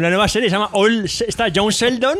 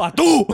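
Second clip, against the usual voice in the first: «tú» agudo, con golpe fuerte; acento en la U; 6 semitones higher, 3 dB louder than usual. Shown as a demonstration. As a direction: sube de tono y clava «tú». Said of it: "poniendo acento en la U". («tú» exclamado, muy tónico)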